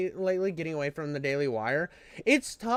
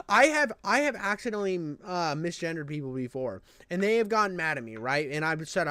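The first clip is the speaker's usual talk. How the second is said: ordinary talk, nothing marked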